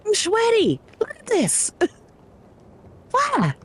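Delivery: very high, squeaky mock distress; high-pitched comic whimper; squeaky whine. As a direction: squeal about her sweaty armpit. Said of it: "She's like".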